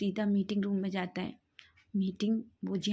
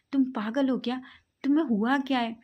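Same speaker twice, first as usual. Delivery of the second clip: louder, higher, exasperated; exasperated shouting; 4 semitones higher, 6 dB louder than usual. Acted. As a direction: yell it at your crazy friend. Heated argument between friends.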